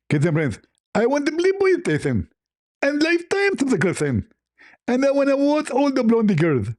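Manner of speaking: greedy demands in a high caricature voice; hammed-up, high-pitched haggler; Zakir Naik. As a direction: squeal out a mock wish list. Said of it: "Ah, Zakir Naik, you remind me".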